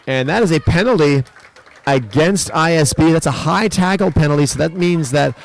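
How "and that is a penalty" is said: ordinary talk, nothing marked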